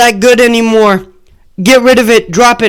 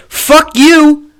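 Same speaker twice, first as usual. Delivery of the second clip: loud, high, swaggering mock Biden; cocky caricature of the president taunting critics; 7 semitones higher, 4 dB louder than usual. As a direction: bark it like a bully daring them. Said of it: "Joe Biden goes".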